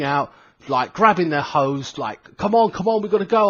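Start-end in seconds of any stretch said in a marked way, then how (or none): 2.4-3.5 high-pitched imitated hurry-up; rally the crew to move, voice raised; mock fireman rushing everyone along; urgent rallying call. Firemen urging each other to hurry.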